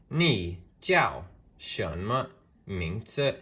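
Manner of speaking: "Ni jiao shenme mingzi?" said slowly, each Mandarin tone spelled out; slow Mandarin demo. Shown as a demonstration. Teacher modelling the question for students, slow and careful. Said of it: "which I went over in a past video".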